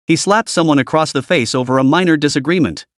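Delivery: ordinary delivery, nothing marked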